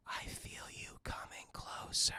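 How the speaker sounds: breathy menacing whisper, slow and close; creepy whisper. Retold or imitated. Stalker in the sewer whispering to Willie, eerie whisper. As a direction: hiss it into the dark like a stalker. Creepy figure speaks in the dark.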